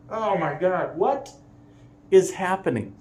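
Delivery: can't believe your eyes; disbelief. Real, not acted. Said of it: "own disbelief".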